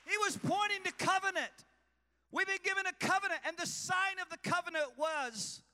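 loud, high, shouted sermon; preaching at full force. Real, not acted. Pastor shouting covenant truth to his church. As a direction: drive it home to the congregation.

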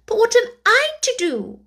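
put-on high, helpless young girl. A narrator's reading. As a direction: lift the pitch, at a loss. Alice voice in story.

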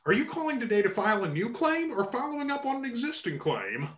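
polite call-centre agent voice; customer service voice. Shown as a demonstration. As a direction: recite it like a phone support script. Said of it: "I have a completely different customer service voice".

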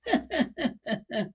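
high, spooky cackle after a gag; eerie cackle. Acted. Ghoulish host to his audience, high eerie cackle.

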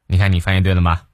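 ordinary talk

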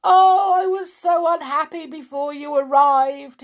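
high, loud self-pitying wail. A narrator's reading. King blames the newly arrived visitor, wailing. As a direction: bawl it theatrically. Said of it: "he wailed".

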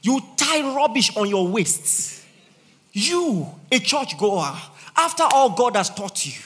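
loud, high scolding; preacher scolding the churchgoers, loud; rebuking shout. Real, not acted.

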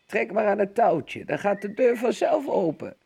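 hoger, licht schor oud vrouwtje; schor roepende oma. A narrator's reading. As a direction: roep als een zieke oma vanuit bed. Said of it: "riep grootmoeder schor vanuit haar bed". (grootmoeder roept vanuit haar bed, licht schor)

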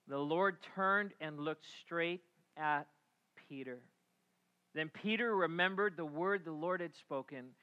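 usual speaking voice

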